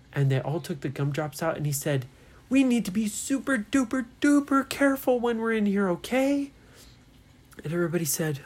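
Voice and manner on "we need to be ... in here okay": high-pitched, anxious-sounding caution; urgent warning. Acted. Ryan warns friends inside the dangerous cave, urgently. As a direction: warn them all with mock urgency.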